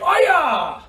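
high, loud kiai; karate shout. Shown as a demonstration. Teacher as the big mean guy attacking. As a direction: yell as you swing in. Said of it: "Ready, Sam?".

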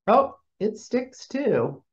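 normal talking voice

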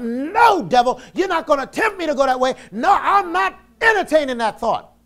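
defiant high shout at the devil; resisting the devil. Pretend. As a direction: push the devil away with every word. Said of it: "I resist".

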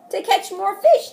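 a hint of a proud father; easy storybook dad; lightly voiced dad. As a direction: answer like Dad explaining.

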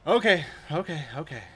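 loud, high alarmed okays; panicked. Real, not acted. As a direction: panic under attack. Panicking under attack.

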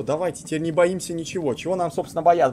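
ordinary talk

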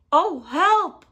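high, loud cry for help; alarmed bark. A narrator's reading. Fox sees the Gruffalo, loud alarmed bark. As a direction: yelp it out like a frightened fox. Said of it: "barked Fox".

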